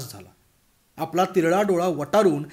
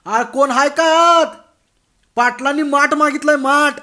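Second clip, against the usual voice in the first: louder shout; 9 semitones higher, 10 dB louder than usual. Acted. Bhagwan raising his voice again at the silent house, shouting. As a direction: raise the pitch and yell. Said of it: "आवाजाची पट्टी चढवून तो पुन्हा ओरडला".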